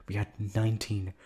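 ordinary delivery

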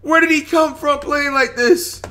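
loud, high, slow disbelieving yell; real amazed shouting; shocked shout. Real, not acted. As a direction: scream his name in awe. Murray scores again.

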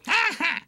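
loud, high-pitched short giggle; cheeky baby laugh. A narrator's reading. Baby toy mocks the woken toys, cheeky laugh. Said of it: "grinned Pamper".

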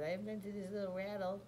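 put-on high babbling baby voice; funny baby voice. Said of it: "I talk, like, real funny".

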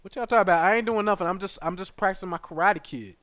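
high, loud playful defense; loud mock protest. Real, not acted. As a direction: protest your innocence. Defending against chat.

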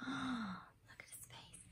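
gasp, then whisper it slowly; a hushed, amazed secret for the kids. Acted. Showing the picture to children.

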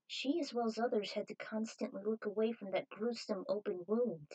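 usual speaking voice

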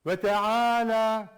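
Ve teala slow and loud, the la stretched; long teala. Shown as a demonstration. Teacher showing students the lengthened teala. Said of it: "Olduğu gibi uzatıyorsun".

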